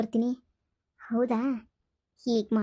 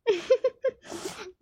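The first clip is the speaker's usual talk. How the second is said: higher, short scheming laugh; sly laugh; 8 semitones higher than usual. Acted. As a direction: laugh at your own trick. Daughter-in-law amused at her plan. (ಕಪಟ ನಗು)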